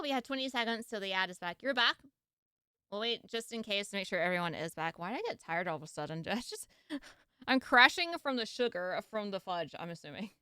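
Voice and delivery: usual speaking voice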